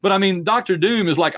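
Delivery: ordinary talk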